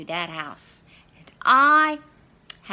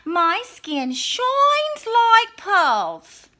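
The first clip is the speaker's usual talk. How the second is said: put-on high, slow vain girl voice; Little Red; 12 semitones higher, 10 dB louder, much slower than usual. Wolf voicing Little Red, high and slow. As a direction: play a vain little girl admiring herself.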